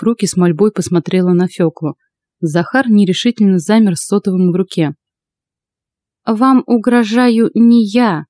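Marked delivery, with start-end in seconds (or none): 6.2-8.2 put-on slow, higher-pitched, composed old woman; even voice. A narrator's reading. Old witch answering an accusation, even and unhurried. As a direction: answer evenly, taking your time. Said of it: "ровным голосом ответила Фёкла".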